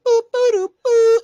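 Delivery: loud, high trumpet fanfare by mouth; imitated trumpets. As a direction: toot it out like brass. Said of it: "Trumpets is going".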